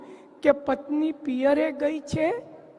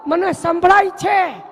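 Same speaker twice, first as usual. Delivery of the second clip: loud reply; 4 semitones higher, 8 dB louder than usual. Acted. Master shouts back to servant.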